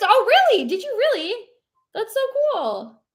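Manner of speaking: high-pitched, thrilled reaction; delighted surprise. Real, not acted. Streamer learning where a viewer met them, delighted surprise. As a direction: gush in real amazement.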